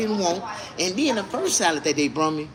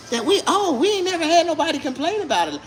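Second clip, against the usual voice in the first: high mimicry of restaurant staff; mock the staff's excuse in a high voice; pitched-up parody of a defensive server; mocking imitation; 12 semitones higher, 3 dB louder than usual.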